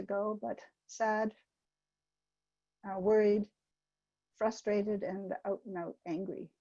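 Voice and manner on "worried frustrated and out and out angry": usual speaking voice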